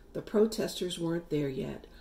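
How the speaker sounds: ordinary delivery, nothing marked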